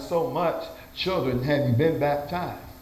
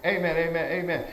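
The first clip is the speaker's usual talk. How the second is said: ordinary delivery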